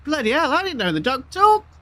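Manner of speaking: high, loud astonished farmer; hammed-up surprise for a joke; mock amazement.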